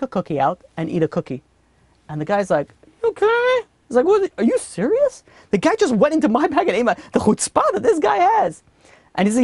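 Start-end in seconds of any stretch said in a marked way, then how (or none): 3.0-4.3 high-pitched mock disbelief; incredulous. Traveler reacting to a stranger taking his cookie, incredulous. 4.4-8.6 high-pitched, hammed-up outrage; indignant disbelief. Traveler whose cookie was just taken by a stranger, indignant.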